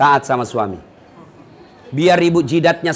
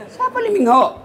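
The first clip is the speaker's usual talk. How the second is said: mock puzzled; 13 semitones higher than usual. Preacher plays himself, puzzled.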